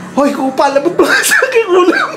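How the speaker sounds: put-on a high, cheeky child's taunt; child's cheeky retort. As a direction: tease like a bold seven-year-old. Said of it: "Sabi ko nga". (pilyong boses ng bata)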